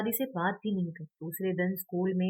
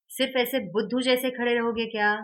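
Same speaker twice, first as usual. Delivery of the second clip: loud, scornful scolding; scolding teacher; 4 semitones higher, 6 dB louder than usual. A narrator's reading. Teacher scolding Vedant for standing silent, loud scorn.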